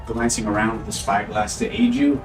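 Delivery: ordinary talk, nothing marked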